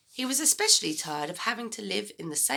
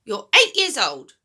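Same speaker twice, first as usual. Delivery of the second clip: loud scornful granny line; Grandma scoffing at George, loud; snorted; 4 semitones higher, 11 dB louder than usual. Acted. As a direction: scoff at the boy like a sour old woman. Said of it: "she snorted".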